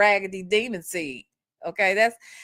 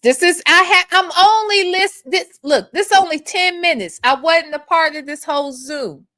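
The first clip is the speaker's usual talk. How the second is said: upset protest; 5 semitones higher, 6 dB louder than usual. Real, not acted. Defends herself, voice cracking.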